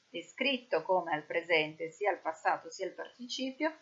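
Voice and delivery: ordinary talk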